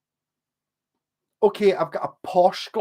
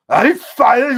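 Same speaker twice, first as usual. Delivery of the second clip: mock baffled Glaswegian; 8 semitones higher, 11 dB louder than usual. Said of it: "they talk to me and it's just like".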